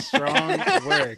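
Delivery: high, bright laughing cheer; laughing congratulations. Real, not acted. Celebrating streamer success, laughing.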